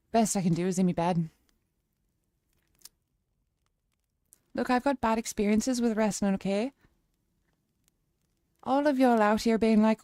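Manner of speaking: put-on Irish lilt; Irish accent. Streamer telling chat her views on wrestling, Irish accent. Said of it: "Let's go Irish".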